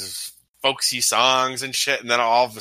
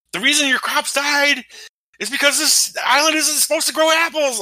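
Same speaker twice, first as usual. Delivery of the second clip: imitating a man pleading his case, high-pitched and raised; mock desperate reasoning; 4 dB louder than usual. Imitating the hero explaining dead crops to islanders, desperate reasoning. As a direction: plead loudly up high, as if arguing for your life. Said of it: "tries to, like, reason with them".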